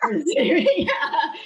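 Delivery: laughing. Real, not acted. Laughs at age reveal.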